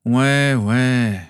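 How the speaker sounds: begrudging, dragged-out assent; grumbling. A narrator's reading. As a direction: grumble it out grudgingly. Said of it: "maugréa son fils".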